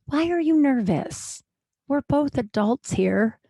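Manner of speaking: slow, patronizing coworker voice; condescending. Retold or imitated. Coworker lecturing the nervous comic, slow and patronizing. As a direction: talk down to her, slowly.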